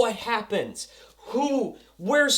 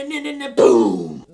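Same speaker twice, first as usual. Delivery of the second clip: slowed hummed build then a loud boom; vocal music sting ending in a boom; mock explosion sound; 8 semitones lower, 8 dB louder than usual. Narrating the detonator scene with a sung boom.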